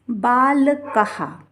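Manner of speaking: बाल कहा drawn out slowly, ending in "ha"; visarga as ha. Shown as a demonstration. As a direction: sound out बाल कहा as "baa-la-ka-ha". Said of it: "इसका उच्चारण हा होगा". (बाल कहा, विसर्ग का हा उच्चारण)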